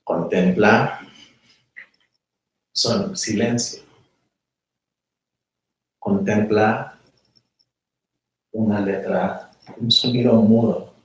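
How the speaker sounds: calm, unhurried meditation voice with long pauses; guided meditation. Acted. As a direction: guide them inward, letting silence sit.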